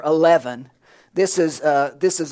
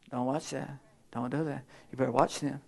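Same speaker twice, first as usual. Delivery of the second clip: very soft and lower, hushed warning; still small voice; 4 semitones lower, 11 dB quieter than usual. Acted. Preacher voicing God's still small voice in your ear, hushed. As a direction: murmur it like a conscience nudging.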